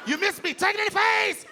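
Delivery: high, loud gloating shout; taunting fly. Acted. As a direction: jeer at the shooter who missed.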